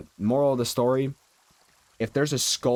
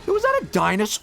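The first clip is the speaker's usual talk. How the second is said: much higher and louder, amazed question; surprised; 11 semitones higher, 4 dB louder than usual. Real, not acted. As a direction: blurt it out in sudden amazement. Spots something in the game.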